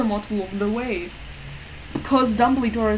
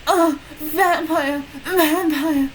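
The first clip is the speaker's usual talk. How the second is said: mock screaming the name; hammed-up scream; 5 semitones higher, much slower than usual. A narrator's reading. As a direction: wail it out slow and high. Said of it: "I screamed, screamed".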